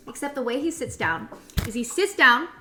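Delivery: normal talking voice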